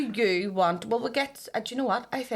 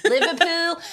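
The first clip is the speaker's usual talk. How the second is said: "In Liverpool" higher and louder, laughing, in Scouse; mock Scouse accent; 10 semitones higher, 9 dB louder, slower than usual. Host redoing the line in a joking Scouse accent. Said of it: "You know the way we all talk like this".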